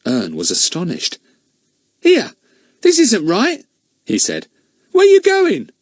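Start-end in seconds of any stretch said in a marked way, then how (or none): 2.0-5.7 put-on high, loud, startled boy; alarmed shout. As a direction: cry out in sudden alarm. Ern alarmed in the car.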